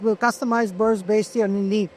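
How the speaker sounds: normal talking voice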